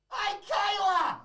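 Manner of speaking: high, drawn-out sing-song call of her name; shrill call out. Retold or imitated. Calling out to Kayla backstage, a high drawn-out mock greeting. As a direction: stretch the name out like a cheery greeting across the room.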